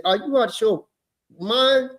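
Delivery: indignant, slowing to hit each word; angry confrontation. Retold or imitated. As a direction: lay into him like an offended father. Retold angry confrontation with teacher.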